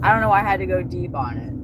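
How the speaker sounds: normal talking voice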